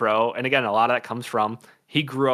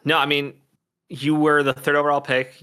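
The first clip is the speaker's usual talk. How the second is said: usual speaking voice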